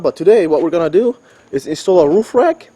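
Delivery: excited voice breaking upward on "rack"; voice cracking up an octave. Real, not acted. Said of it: "Yeah, my voice cracked".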